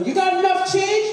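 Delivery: high called-out question; yelling out the window. Retold or imitated. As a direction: call it out the car window to him. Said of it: "yell out the window".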